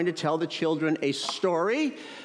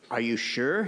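usual speaking voice

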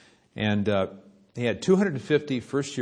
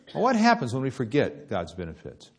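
ordinary talk

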